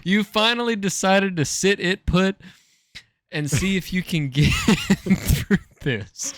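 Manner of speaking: high giggly read-through; laughing while reading. Real, not acted.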